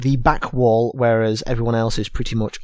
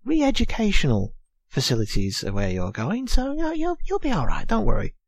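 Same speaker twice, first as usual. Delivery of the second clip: high, soft, stammering comfort; gentle, high-pitched fussing; reassuring prison officer; 6 semitones higher, 4 dB quieter than usual. Acted. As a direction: lean in and soothe in a high little voice. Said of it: "prison officers comes over and leans into the, um, the young girl and goes".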